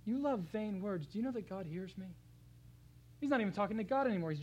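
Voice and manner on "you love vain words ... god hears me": taunting. Acted. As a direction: needle the enemies, then let it drop. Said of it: "he's taunting them".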